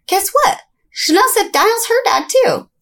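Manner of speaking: put-on high, tattletale kid voice; imitating tattling sister. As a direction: tattle to mom like a little girl. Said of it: "she goes and tells her mom".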